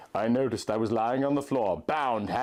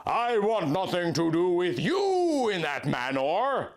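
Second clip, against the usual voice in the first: crying out; 6 semitones higher than usual. A narrator's reading. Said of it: "I cried".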